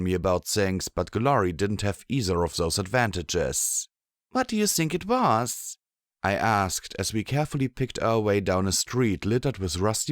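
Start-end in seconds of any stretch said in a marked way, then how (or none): 4.3-5.8 put-on higher young mare voice; Blackjack voice. A narrator's reading. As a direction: voice the heroine, higher. Narrator voicing Blackjack.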